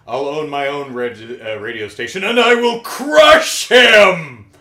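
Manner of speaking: hammed-up vengeful villain; villainous vow. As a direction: vow revenge, swelling into a drawn-out melodramatic shout. Retold villain vows revenge.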